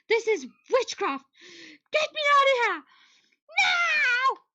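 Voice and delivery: high mock outcry; mock panic. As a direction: shout it like a trapped heroine. Demands to be let out.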